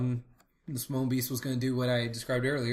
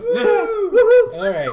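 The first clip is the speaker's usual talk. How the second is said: excited cheer; 14 dB louder, much slower than usual. Real, not acted. Celebrating fiftieth episode.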